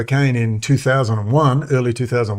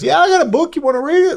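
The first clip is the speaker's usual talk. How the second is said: much higher, put-on voice, a bit louder; Roger offering; 3 dB louder, faster than usual. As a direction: pitch the old man's offer high and eager. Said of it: "And he goes".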